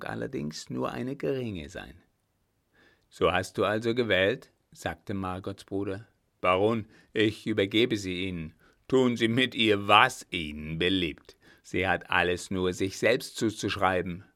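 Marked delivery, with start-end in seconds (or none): 3.1-4.5 sharp, loud rebuke; angry brother. Acted. Brother confronts his sister. 6.4-14.2 loud, harsh renunciation; cold angry brother. Acted. Brother hands sister over.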